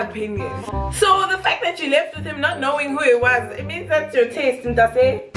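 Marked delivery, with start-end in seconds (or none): none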